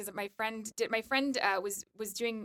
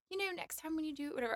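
soft, higher-pitched imitation of a British accent; 5 semitones higher, 9 dB quieter than usual. Guest imitating the director speaking to her, soft British accent. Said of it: "with his British accent".

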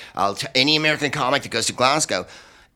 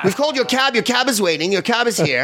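much higher, sing-song dismissal; mocking British crowd; 11 semitones higher than usual. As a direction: shoo the comic off sweetly. Audience telling comic to leave.

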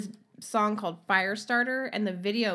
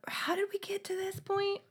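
whispering; 12 semitones higher, 5 dB quieter than usual. Real, not acted. Laughing disbelief, aside.

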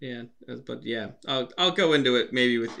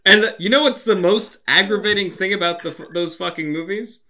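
high, emphatic pet-peeve rant; exasperated rant; 7 semitones higher than usual. Real, not acted. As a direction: build up the grievance, pitched high. Ranting about a sequel's name.

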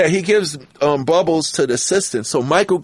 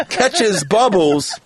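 ordinary talk, nothing marked

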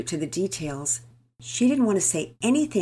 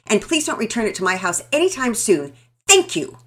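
loud, furious outburst, higher; 6 semitones higher, 11 dB louder than usual. A narrator's reading. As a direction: storm off yelling. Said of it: "loaded for bear".

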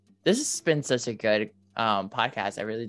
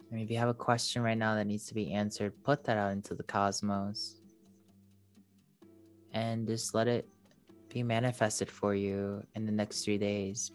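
airy breathy mystical healer; 6 dB quieter than usual. Acted. Leads a meditation.

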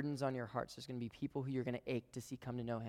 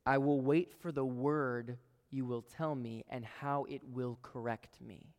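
slow reading; 3 dB louder, slower than usual. Acted. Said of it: "It actually says this".

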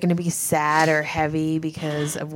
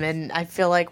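ordinary delivery

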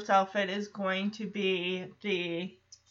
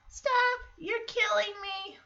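put-on little kid copying; 10 semitones higher, 4 dB quieter than usual. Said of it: "Here comes Michelle".

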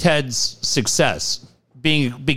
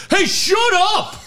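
much higher, drawn-out mock yell; hypnotized man yelling. Hypnotized guy yelling at the host, hammed-up yell. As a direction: bark it at the stage like a madman. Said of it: "He's just screaming".

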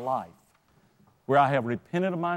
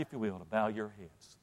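much softer, lower, gentle invitation; soft altar call; 7 semitones lower, 13 dB quieter than usual. Real, not acted. Preacher inviting the congregation to bow heads, softly. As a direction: bring them down to quiet prayer.

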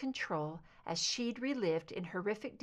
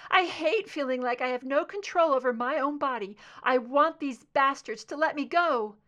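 louder and higher, anger boiling over; furious, shaking; 6 semitones higher, 8 dB louder than usual. A narrator's reading. Scully reliving abduction, to Mulder, loud furious outburst. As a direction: spit it out at her captors. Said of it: "Her voice shook with anger".

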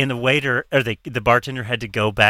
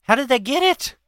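surprised; 10 semitones higher than usual. Real, not acted. Gasps at dropped phone story.

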